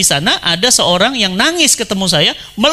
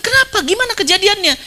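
incredulous question; 10 semitones higher than usual. Retold or imitated. Preacher retells asking prisoner.

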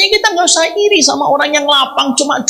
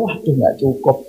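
softer, lower "oh no, not enough"; quietly dismayed friend; dismayed sigh; 9 semitones lower, 5 dB quieter than usual. Retold or imitated. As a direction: let the disappointment sink the voice. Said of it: "Setelah itu beliau".

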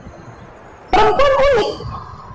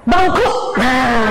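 ordinary talk

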